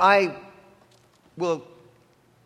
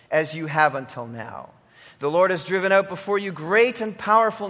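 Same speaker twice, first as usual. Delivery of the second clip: measured, solemn Bible reading to the congregation; scripture reading; 4 semitones lower than usual. Acted. Preacher reading scripture to his church, solemn and measured. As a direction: read the law out like a lector. Reads scripture before the sermon.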